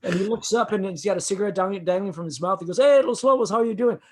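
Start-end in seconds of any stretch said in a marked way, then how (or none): 2.8-4.0 high, loud hello to the band; retold hearty greeting. Musician looking up from foosball, hearty greeting. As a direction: imitate a friendly shouted hello.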